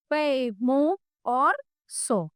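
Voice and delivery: पे, मो और सो spoken very slowly; slow syllable demo. Shown as a demonstration. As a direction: sound out each syllable. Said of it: "यहाँ पे छोटी की मात्रा". (धीरे-धीरे मात्राएँ बोलकर)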